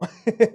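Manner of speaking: a quick loud laugh; loud amused laugh; short burst of laughter. Real, not acted. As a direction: laugh out at the punchline. Laughs at the story.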